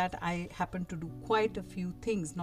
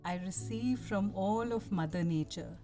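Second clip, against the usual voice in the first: calm guiding voice, unhurried; soft spa whisper; 5 dB quieter than usual. Acted. Leads a guided meditation.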